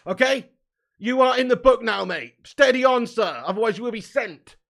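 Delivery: loud, high stern telling-off; stern referee warning. Pretend. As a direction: warn him like a strict ref.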